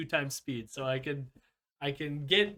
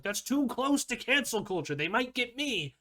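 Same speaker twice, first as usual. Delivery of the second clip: higher-pitched mock alarm; mock-fretful higher voice; mock indignant Ben Shapiro; 8 semitones higher than usual. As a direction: put on a worried, higher voice as a mocking quote. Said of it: "if he would still get, like".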